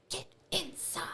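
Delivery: high, strained whispered order; stern mom. Retold or imitated. Mom at front door to kids crawling in, stern. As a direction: order them in, half-whispered. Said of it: "And so her mom's".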